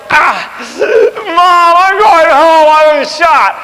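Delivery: loud, high crying shout for mom; the soldier who breaks ranks crying; mock wailing. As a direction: ham up a panicked wounded soldier. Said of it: "you would never go".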